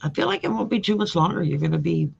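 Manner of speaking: usual speaking voice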